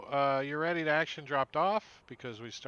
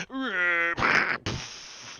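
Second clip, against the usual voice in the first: owlbear screech; 6 semitones higher, 8 dB louder than usual. Acted. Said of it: "the owlbear goes".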